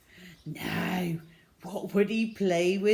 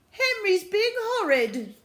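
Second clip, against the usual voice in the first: whiny complaint; 6 dB louder than usual. Acted. Steve complaining.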